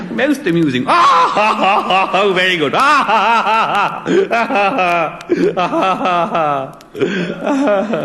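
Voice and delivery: loud, high hammed-up ha-ha laughter; mock chuckling away. DJ amused by the record, exaggerated laughing impression. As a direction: build a put-on guffaw between approving remarks. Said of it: "he was chuckling away".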